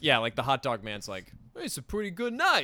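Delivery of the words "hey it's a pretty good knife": higher, casual street vendor voice; a lightly voiced New York vendor sizing up a deal; hot dog vendor. Vendor haggling with Jason, light street vendor voice.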